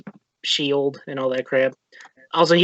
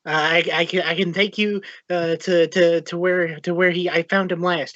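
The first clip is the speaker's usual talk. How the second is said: halting, flustered stammer; stumbling over words; 2 semitones higher than usual. Acted. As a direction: trip over the words, restarting again and again. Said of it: "He's, like, stumbling all over his words".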